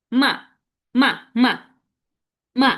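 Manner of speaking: short, loud "মা" dropping sharply from high; falling fourth tone. Shown as a demonstration. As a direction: throw each "মা" down fast. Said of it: "falling tone". ("মা" জোরে, উপর থেকে দ্রুত নিচে)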